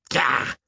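high disgusted retch; retching cry. A narrator's reading. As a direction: retch out loud in revulsion. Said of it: "retched Peg".

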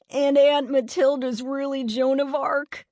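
snickering mockery. A narrator's reading. Eddie ridicules Liza's ghost idea, snickering tease. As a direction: jeer it as if about to snicker. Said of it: "Eddie snickered".

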